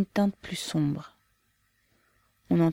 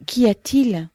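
sharp alarmed cry, higher and louder; 6 semitones higher, 7 dB louder, slower than usual. A narrator's reading. Lyudmila runs to the dying man, crying out in alarm. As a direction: call out in sudden alarm. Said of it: "s'écria Lyudmila".

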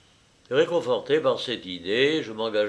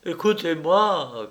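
raised, pleading cry; urgent cry, moderately raised; 6 semitones higher than usual. A narrator's reading. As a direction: call out in higher-pitched appeal. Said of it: "criai-je en".

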